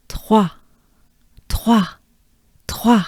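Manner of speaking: trois crisp and clipped, TWAH, falling; French trois. Shown as a demonstration. Teacher drills trois all together, crisp. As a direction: snap out trois in one go. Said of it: "get this all together at once".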